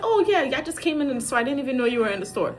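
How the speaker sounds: high, hurried fake-casual excuse; feigned innocence. Caught mom lying to the kids, feigning innocence. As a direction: gush an innocent cover story.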